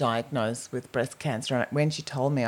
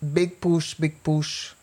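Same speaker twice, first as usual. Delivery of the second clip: Russian obstetrician encouraging; much slower than usual. Retold or imitated. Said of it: "gives some encouragement".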